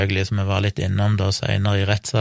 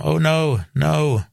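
higher-pitched, drawn-out "oh no"; retold pleading; 7 semitones higher, much slower than usual. Retelling a victim begging captors to stop, pleading. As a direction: quote a victim's plea, pitched up. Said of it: "be om at de stopper".

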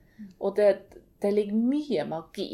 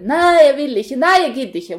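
loud, high furious refusal; roaring teen; 5 semitones higher, 13 dB louder than usual. Retold or imitated. Daughter roaring no at her mother, loud defiant yell. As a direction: roar it back like a defiant sixteen-year-old. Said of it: "hun brukte å brøle".

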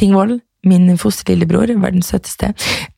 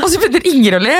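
ordinary talk

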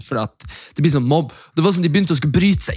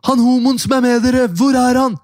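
high, louder mock demand; angry teen yelling; 10 semitones higher, 4 dB louder than usual. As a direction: imitate a mob of angry teens. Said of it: "Jeg bare". (sint imitert rop)

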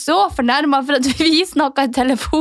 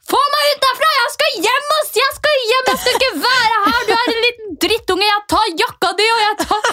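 high, shrill-free mock rant; furious woman; 9 semitones higher than usual. The furious woman at his place, high mock rant. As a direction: imitate an angry woman demanding to leave. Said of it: "en fly forbanna dame".

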